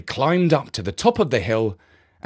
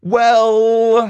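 drawn-out well; 11 semitones higher, 9 dB louder than usual. Acted. Jeff objects.

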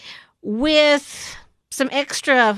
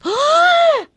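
gasp; 6 dB louder than usual. Retold or imitated. Mock shock at reveal.

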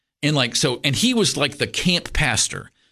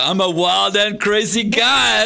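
higher, slow, hammed-up catchphrase; mock comedian, drawn out and pitched up; Steve Martin bit; 9 semitones higher, 4 dB louder, slower than usual. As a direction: do the wild and crazy guy. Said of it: "[name] does the ... thing".

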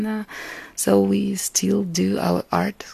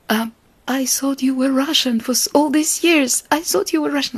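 higher, hesitant voice for the caretaker; puzzled caretaker; 8 semitones higher than usual. Retold or imitated. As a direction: voice the friend who just realised, pitched up. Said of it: "And she was like".

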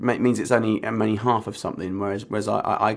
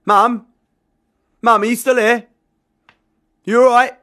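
anxious call into the flat, louder and higher; 12 dB louder than usual. Acted. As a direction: call for Mum across an empty flat. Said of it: "called again".

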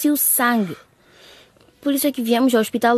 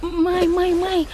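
high, alarmed calls to wake her mother; urgent calling; 7 semitones higher, much slower than usual. Acted. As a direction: shake her awake with your voice. Daughter wakes mother in alarm. (chamar a mãe, alarmada e aguda)

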